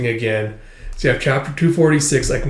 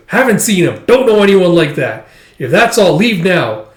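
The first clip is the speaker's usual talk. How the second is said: louder, raised-pitch brush-off; curt dismissal; 5 semitones higher, 6 dB louder than usual. Retold or imitated. Villager turning away strangers looking for people, dismissive. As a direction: send them away firmly.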